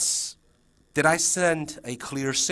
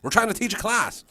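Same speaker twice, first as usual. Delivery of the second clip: higher-pitched mock complaint; annoyed neighbouring teacher; 8 semitones higher than usual. Teacher next door complaining about noise, mock annoyed. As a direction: protest the noise, mildly hammed-up. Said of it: "knocking on the door and say".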